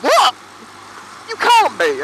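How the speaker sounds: high, loud incredulous "what"; acted-out astonishment, pitched right up; mock disbelief. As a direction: imitate a stunned colleague refusing to believe it. Acts out colleagues' disbelief at age.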